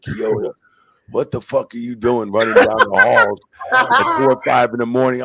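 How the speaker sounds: loud scolding from the office, imitated; angry agent on the phone. Agent calling to yell about noise at dawn, loud scolding. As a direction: chew him out for running the halls. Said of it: "agents going".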